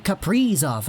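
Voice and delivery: Kaprizov as ka-PREE-zov, middle leaned on; mock the stressed middle of Kaprizov; stress on "pri". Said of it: "put the emphasis on the pre".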